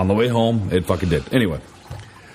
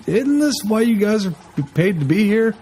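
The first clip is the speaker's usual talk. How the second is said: exasperated; 11 semitones higher than usual. Retold or imitated. Exasperated rhetorical complaint at staff.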